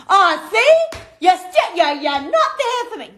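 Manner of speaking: high, loud hammed-up wounded whine; high loud mock whine, blaming her husband; mock hurt whine. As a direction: play the wounded partner big. Her hurt reply acted.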